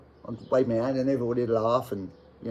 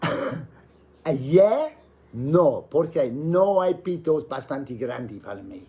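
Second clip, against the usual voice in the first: defiant comeback; 5 semitones higher, 4 dB louder, much slower than usual. Retold or imitated. His comeback reply to crowd.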